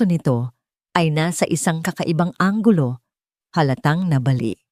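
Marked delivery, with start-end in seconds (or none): none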